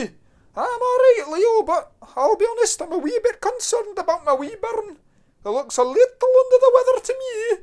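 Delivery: high, loud broad Scottish mother; broad Scottish accent. Acted. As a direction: play a doting Scottish mum, high and loud. Scottish mum worries about son.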